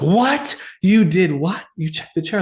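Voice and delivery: imitating the owner's stunned reaction; shocked disbelief.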